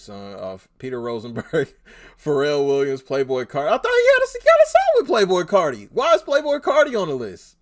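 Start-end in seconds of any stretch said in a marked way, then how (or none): 3.7-7.6 high, loud baffled outburst; incrédule. Real, not acted. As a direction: blurt it out in disbelief, much higher and louder. Baffled by a name on list.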